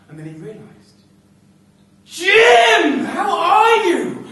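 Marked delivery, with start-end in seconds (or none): 2.0-4.3 calling out a friend's name with delight; high, loud, laughing greeting; overjoyed to hear an old friend; joyful shout. Acted.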